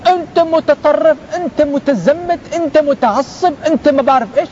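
high, drawn-out name-calling; mock accusing. People branding a devout man an extremist, mock accusing.

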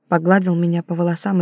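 ordinary delivery

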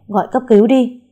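loud, high urgent order; urgent hurry. A narrator's reading. Mother urges the girls to call an ambulance, loud and urgent. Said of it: "Mẹ cô giục".

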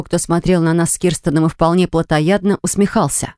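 plain reading voice

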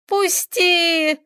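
high, pained plea; high cry to break free; cried out. A narrator's reading. Girl in pain to her attacker, high cry. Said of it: "закричала Таисия".

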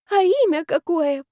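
high, slow, lovesick croon; drunkenly smitten, stretched out high; dreamy tipsy gushing. A narrator's reading. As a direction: swoon over the name. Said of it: "продолжала я опускать розовые пузыри".

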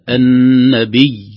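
"النبي" drawn out, loud, with stressed ي; doubled final ya. Shown as a demonstration. Teacher repeats correct stop, drawn-out doubled ي. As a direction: lean hard on the last ي of "النبي". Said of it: "الياء مشددة يوقف عليها بشدة".

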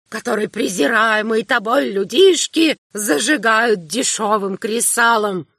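put-on high, slow, cracked old man's shout; a doddering elder screaming a curse; old man's crazed shrieking. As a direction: rave like a half-mad old prophet. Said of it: "выкрикивал ... дребезжащим старческим голосом".

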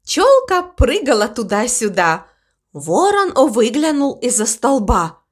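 ordinary delivery, nothing marked

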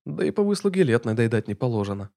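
plain reading voice

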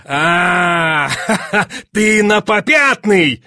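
loud cry with a laugh; high, drawn-out laughing taunt; jeering triumph. A narrator's reading. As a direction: crow that he is backing out. Duke laughs at fencer backing down.

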